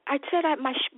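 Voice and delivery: normal talking voice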